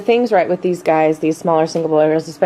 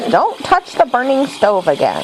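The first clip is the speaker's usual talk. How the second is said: mom voice; 6 semitones higher than usual. Retold or imitated. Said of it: "I had to use my mom voice on you".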